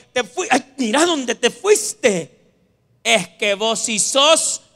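loud, drawn-out exasperated reproach; hammed-up fed-up scolding; mock scolding. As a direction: tell them off slowly, fed up. Acts scolding someone who ignored warnings.

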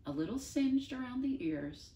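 ordinary delivery, nothing marked

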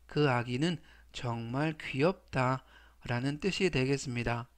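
normal talking voice

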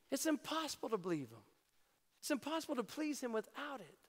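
usual speaking voice

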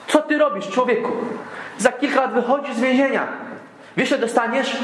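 a guard scoffing at the prisoner's folly; disbelieving guard. Retold or imitated. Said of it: "Strażnik się popukał w głowę".

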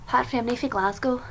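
put-on a light, quick Glaswegian accent. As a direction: rattle it off in a bad Glaswegian accent. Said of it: "It's a terrible Glaswegian accent".

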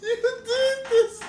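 high, squeaky words through laughter; real giggling fit, voice way up; helpless laughter. Real, not acted. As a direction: squeeze the apology out while cracking up. Helpless laughing fit after mishap.

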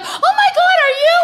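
high gushing stranger's squeal; excited stranger. Retold or imitated. Stranger gushing over my belly, high and excited. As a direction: gush like a nosy stranger. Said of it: "She's like".

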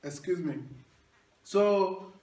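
ordinary delivery

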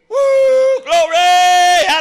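high whooping woo then a loud slow shout; excited whoop. Real, not acted. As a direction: let the spirit lift you into a cry. Preacher whoops mid-sermon.

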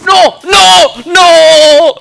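loud, high disbelieving yells breaking into laughter; real stunned cries with a laugh; shocked shouting. Real, not acted.

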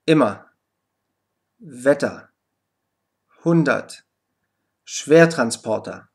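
unstressed er as "ah" in "Immer", "Wetter", "hundert". Shown as a demonstration. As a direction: separate model words, endings reduced to a short open vowel. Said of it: "die ebenfalls A ausgesprochen wird".

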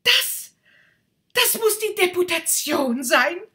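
puffed up with excitement; excited rocket. Acted. Rocket mistakes the boys for a deputation, loud and thrilled.

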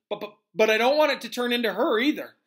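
loud, high stammering objection; flustered protest. Acted. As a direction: blurt it out in worried protest. Nervous boy protests in story.

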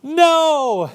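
high, loud warning yell; frantic child's shout; crying out a warning. Retold or imitated. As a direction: shout to stop your mother walking into danger. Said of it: "I cried out".

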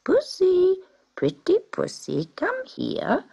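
high, sing-song call to a cat; old man calling pussy in a high coaxing voice; calling sweetly. A narrator's reading. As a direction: coax the cat high and lilting. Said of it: "a voice called".

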